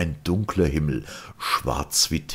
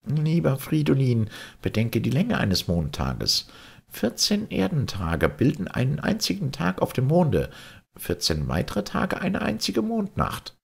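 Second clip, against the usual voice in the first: raised, calm voice of the Martian guide Sirian; patient alien captain explaining, voiced higher; Sirian's voice; 11 semitones higher than usual. A narrator's reading. Sirian instructs Fridolin. (Sirian, höher und ruhig erklärend)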